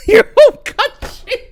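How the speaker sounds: high, loud words breaking into laughter; cracking up. Real, not acted. Echoing the clip's claim, helpless laughter. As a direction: lose it laughing mid-phrase.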